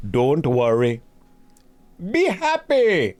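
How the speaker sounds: hammed-up fake Jamaican, leaping high at the end; bad Jamaican accent. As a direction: badly imitate a Jamaican accent, drawn out. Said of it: "You're just doing Jamaican badly".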